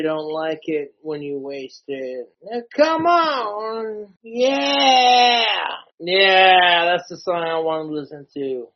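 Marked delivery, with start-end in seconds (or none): 2.4-6.8 loud, drawn-out cheer; cheering shout. Real, not acted. Greeting his favourite song, a loud cheering shout. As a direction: yell at the song starting.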